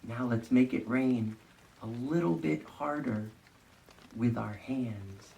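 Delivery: hushed, unhurried teacher leading a movement game; gentle guided movement. Acted. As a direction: guide the kids calmly through each shape. Guided movement storytime for children.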